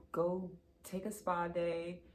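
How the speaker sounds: usual speaking voice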